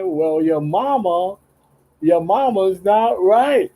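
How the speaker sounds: high, slow mock voice of his mama; lightly put-on high motherly drawl; imitating mama. Mama scolding him in a high, slow voice. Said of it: "my mama said".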